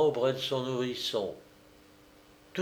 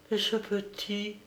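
soft worried question; 8 semitones higher, 7 dB quieter than usual. Acted. Mother asks about the other baby.

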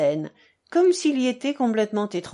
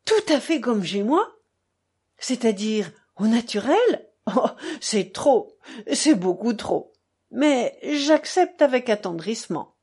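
effusive buffoon. Acted. Fiodor answers the staré's invitation to be natural, effusively clowning. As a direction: play the clown, overflowing with enthusiasm.

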